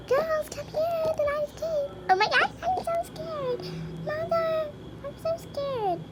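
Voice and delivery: put-on little girl calling mother in fright; high mock-scared child. Child character calls mother, scared.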